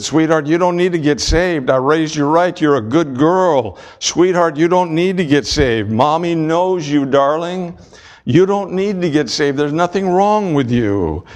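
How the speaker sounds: high, sugary mother's voice pleading with her daughter; doting mother. Retold or imitated. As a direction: coo like an overprotective mom. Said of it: "The mother said".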